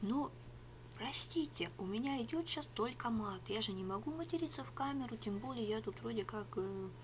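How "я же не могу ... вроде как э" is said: ordinary talk, nothing marked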